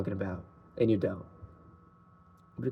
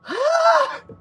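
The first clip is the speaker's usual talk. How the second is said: very high-pitched squeal of a laugh; overwhelmed high laughing squeal; 3 dB louder than usual. Real, not acted. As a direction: let out a high, delighted laugh. Overwhelmed by own song.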